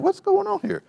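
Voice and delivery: put-on high, small kid's voice asking what's happening; puzzled child voice. Child watching frustrated father, high puzzled voice. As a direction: squeak it out like a baffled little kid. Child voicing confusion about dad.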